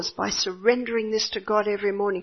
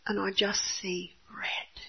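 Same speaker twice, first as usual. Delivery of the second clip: whisper; 9 dB quieter, slower than usual. Retold or imitated. Mother telling of her anger.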